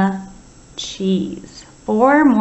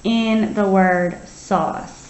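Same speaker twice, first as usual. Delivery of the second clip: slow, careful word; slow sounding out; slower than usual. Shown as a demonstration. Teacher stretching a word for young spellers. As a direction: drag out sauce for listening. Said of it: "letters that you hear".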